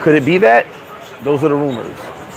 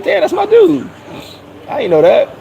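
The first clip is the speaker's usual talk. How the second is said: surprised; 13 semitones higher than usual. Real, not acted. Surprised by news on chat.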